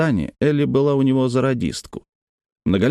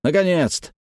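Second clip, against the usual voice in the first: higher, relieved exclamation; mildly excited, pitched up; exclaiming; 7 semitones higher than usual. A narrator's reading. Vet relieved to get radio contact, exclaiming. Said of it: "воскликнул Хардинг".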